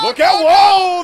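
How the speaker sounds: loud urgent call-out; warning shout. Real, not acted. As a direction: yell a warning to the ring. Sudden attack at ringside.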